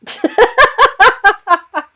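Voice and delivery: high, loud cackling burst; loud laughter. Real, not acted. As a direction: laugh hard at your own mishap. Laughs at her own fall.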